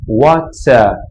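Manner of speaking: Water. with no final R, long open A, crisp t; British English Water. Shown as a demonstration. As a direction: drop the R in Water. and stretch the vowel. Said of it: "você não pronuncia o R final, você só alonga o A".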